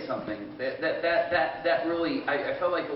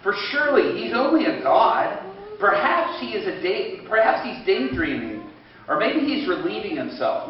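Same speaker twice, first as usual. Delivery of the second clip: raised, mocking scripture reading; lightly acted taunt; scoffing; 5 semitones higher, 5 dB louder than usual. A narrator's reading. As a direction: read it as a loud scoff. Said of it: "He scoffed".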